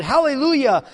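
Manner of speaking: high, loud cry of praise; exultant shout. Acted. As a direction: shout it in triumph. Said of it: "And again they shouted".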